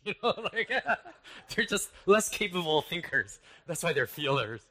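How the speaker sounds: high-pitched, laughing through the words; laughing aside. Real, not acted. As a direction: crack up at your own judgy opinion. Laughing at his old judgmental view.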